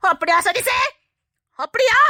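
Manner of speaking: a mother rounding on her son; mock outraged question.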